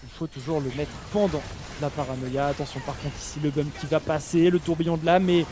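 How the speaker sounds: high-energy live commentary, pitch up; esports caster. Real, not acted. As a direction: hype the teamfight for viewers.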